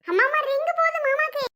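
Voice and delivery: high-pitched, thrilled announcement; excited child. Acted. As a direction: call out the good news, voice leaping up. Child hears uncle's phone ringing.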